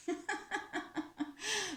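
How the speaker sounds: high, soft mouse giggle; bursting out laughing. A narrator's reading. Mouse laughing at the fooled bird, bursting out laughing. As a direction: let out a staccato high-pitched cackle. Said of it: "éclate de rire".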